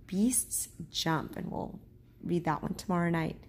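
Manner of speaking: usual speaking voice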